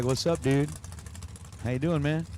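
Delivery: normal talking voice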